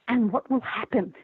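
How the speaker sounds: lightly imitated British accent. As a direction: quote him with a hint of British. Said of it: "with this great British accent".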